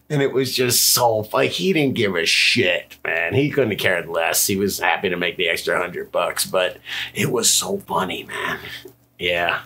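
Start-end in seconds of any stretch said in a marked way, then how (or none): none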